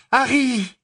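upset Hermione, high and loud; exclaiming. A narrator's reading. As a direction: cry out in alarm at a friend. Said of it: "s'écria Hermione".

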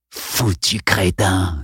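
slow hissed insult; villain hiss. Acted. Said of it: "siffla-t-il".

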